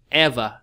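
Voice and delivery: "ever" with a loud first syllable, falling away; teacher's model word, EV-er; first-syllable stress. Shown as a demonstration. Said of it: "nó sẽ là nhấn âm một".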